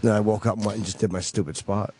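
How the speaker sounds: ordinary delivery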